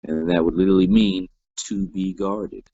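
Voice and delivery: ordinary talk, nothing marked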